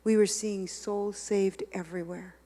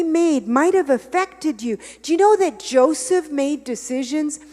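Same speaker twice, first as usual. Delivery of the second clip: louder, higher, driving sermon delivery; fervent preaching; 6 semitones higher, 10 dB louder than usual. Acted. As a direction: preach it hard to the congregation. Preaching at full force.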